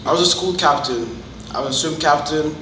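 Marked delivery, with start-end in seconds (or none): none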